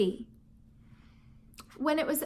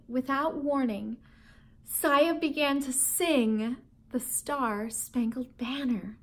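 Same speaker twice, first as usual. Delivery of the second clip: usual speaking voice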